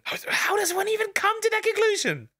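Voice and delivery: very high, strained disbelief; incredulous squeak. Real, not acted. Incredulous at racist accusation.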